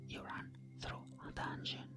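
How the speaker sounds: ordinary talk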